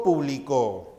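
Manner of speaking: "publicó" stressed on the final CÓ; stress on last syllable. Shown as a demonstration. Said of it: "El acento señala a la mayor fuerza de voz".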